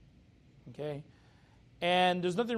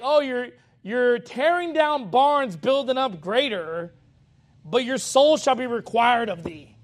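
slow, louder mock rebuke from God; 4 semitones higher, 7 dB louder, slower than usual. Preacher voicing God warning the rich fool, slow and louder. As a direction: drag it out like a warning. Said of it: "So it's just like".

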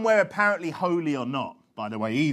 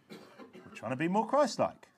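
slow, quiet, understated remark; soft aside; 8 dB quieter, slower than usual. Real, not acted. Preacher explaining why they shout, first softly and slowly. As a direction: say it gently, held back. Same line repeated, soft then raised.

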